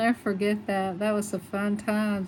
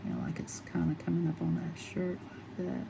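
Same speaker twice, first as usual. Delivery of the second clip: whisper; 7 dB quieter than usual. Real, not acted. Quiet aside while painting.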